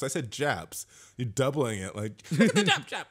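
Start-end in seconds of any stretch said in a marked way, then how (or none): 2.3-3.0 high-pitched mock imitation of a friend; mocking impression. Mocking a friend's doubled-up word, mocking impression. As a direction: squeal it high, making fun of him. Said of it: "that's you".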